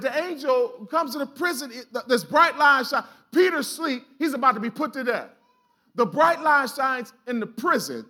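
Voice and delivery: normal talking voice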